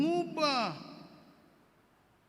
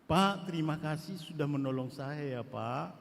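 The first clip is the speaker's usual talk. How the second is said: grateful thanks; 6 semitones lower than usual. Shown as a demonstration. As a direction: thank him humbly and gently.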